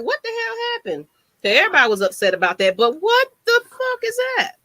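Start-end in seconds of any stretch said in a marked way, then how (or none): 0.0-1.1 high, hushed "what the hell"; mock shock. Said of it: "'cause I was like". 3.0-4.5 can't believe what you're reading; incredulous. Real, not acted. Incredulous at prediction.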